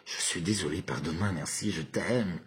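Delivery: low, softened, longing delivery; with desire. Shown as a demonstration. Showing viewers the mantra with desire, low and tender. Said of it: "Avec désir".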